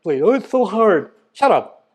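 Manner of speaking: usual speaking voice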